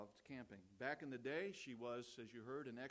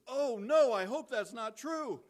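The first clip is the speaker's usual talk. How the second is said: sudden alarmed outburst; 10 semitones higher, 16 dB louder, slower than usual. Retold or imitated. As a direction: blurt it out much louder and higher, in mock alarm. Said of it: "Denny, the silent one, all of a sudden goes".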